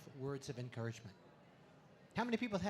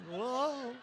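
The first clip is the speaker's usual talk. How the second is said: high loud [name] whoa; 7 semitones higher, 6 dB louder than usual. Acted. Comic swami crowing to the crowd, high [name] whoa. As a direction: toss out a big [name] whoa.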